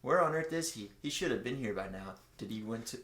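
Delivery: ordinary delivery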